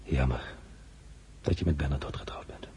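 low, hushed regret; regretful whisper. Acted. Emperor whispering his regret to Eugenie. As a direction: let the regret slip out in a whisper.

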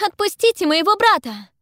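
high, loud desperate demand; high-pitched full shout. A narrator's reading. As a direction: shout for your brother's release. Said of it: "кричу я".

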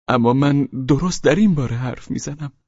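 high insistent voice; insisting Pavel Pavlovich. Acted. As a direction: press the point.

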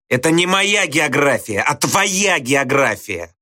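high, forceful retort; Slushkin pressing hard. A narrator's reading. Teacher corners the pupil over geography, pressing hard. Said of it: "теснил Слушкин".

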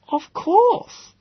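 a sly high "Ah"; high grinning exclamation. Acted. Eccentric professor greets the visitor, high and pleased. Gödel character voice.

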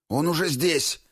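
high, urgent warning cry; startled alarm. A narrator's reading. Moose warns the squad the enemy arrived, alarmed. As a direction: jump in sharply, pitch shooting up. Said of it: "встрепенулся Лось".

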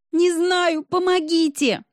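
high-pitched scream for help; ringing desperate cry. A narrator's reading. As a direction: cry out in sheer despair, voice high. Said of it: "В Сонином крике было столько звенящего отчаяния".

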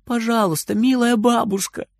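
put-on high, thin childish pleading; thin little voice. A narrator's reading. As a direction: beg in a tiny high voice. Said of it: "совсем уже тоненьким голоском". (тоненький умоляющий голосок)

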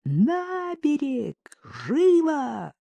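high, long-drawn calling holler; high sustained bellow, held back in volume; full-throated yell. A narrator's reading. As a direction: call across water, stretching each word. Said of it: "завопил во всё горло".

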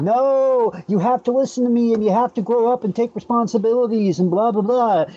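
high, whining lecture in a put-on voice; mock whiny soy boy. Mocking the upset guy from the meme in a high whine. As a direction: whine it out like a nagging scold. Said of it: "he's whining".

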